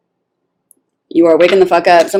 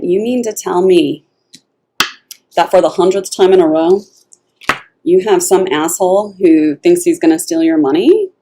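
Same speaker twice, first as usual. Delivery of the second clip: ordinary talk, nothing marked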